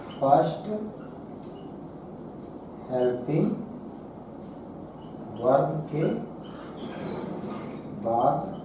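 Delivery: soft, low, with long silences between words; word-by-word dictation. Shown as a demonstration. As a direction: dictate the answer at writing speed. Writing the answer word by word. (जवाब धीमी आवाज़ में रुक-रुककर लिखवाते हुए)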